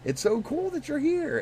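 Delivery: high, bright fake enthusiasm; high mock-friendly voice. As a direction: gush it in a falsetto. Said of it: "everyone was like".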